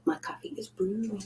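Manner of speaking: soft far-off whisper; secretive little whisper. Pretend. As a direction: breathe it out with no voice. Whispered aside.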